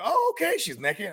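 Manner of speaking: surprised. Retold or imitated. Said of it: "I'm like".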